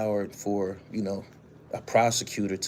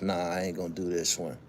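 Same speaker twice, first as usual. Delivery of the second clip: lower, softer imitation of her private thought; prosecutor talking to herself; 5 semitones lower, 8 dB quieter than usual. Imagined prosecutor privately declining the case, low self-talk. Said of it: "says to herself".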